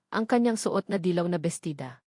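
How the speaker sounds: ordinary delivery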